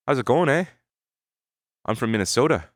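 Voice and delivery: put-on light Canadian accent, "eh" tagged on; breezy put-on Minnesota Canadian accent. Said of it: "Minnesota accents, what do they sound like? It's basically Canadian".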